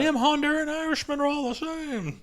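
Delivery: rally the boys like a cartoon bigot; hammed-up rabble-rouser; mock bigot.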